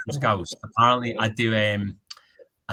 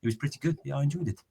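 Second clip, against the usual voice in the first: South African accent, chatty film review; casual South African storyteller. Shown as a demonstration. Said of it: "a decent South African".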